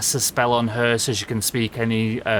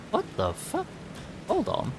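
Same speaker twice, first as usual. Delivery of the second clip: startled; 11 semitones higher, 9 dB quieter than usual. Real, not acted. Sudden confusion in game.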